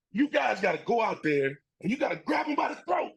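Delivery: high-pitched, contained mock yell; imitated coach's yell. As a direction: act out a coach rallying players. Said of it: "He was yelling, he's screaming".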